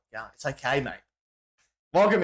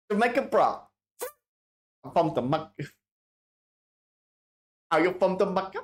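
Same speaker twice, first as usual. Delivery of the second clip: lightly hammed-up Jamaican accent; joking attempt at a Jamaican accent; mock Jamaican accent; 5 semitones higher than usual. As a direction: put on a playful Jamaican lilt. Playfully answering a Jamaica question.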